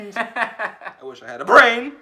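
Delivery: high, loud, drawn-out wish through laughter; laughing, self-mocking lament; laughing wail. Real, not acted. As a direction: cry it out while laughing, stretching the last word.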